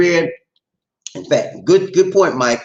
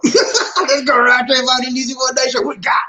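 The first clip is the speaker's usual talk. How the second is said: high-pitched, shouted catchphrase; hyped call-out; 10 semitones higher, 3 dB louder than usual. Pretend. Host calling for the next listener, hyped high shout. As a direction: call out the show's slogan high and excited. Show catchphrase called out loudly.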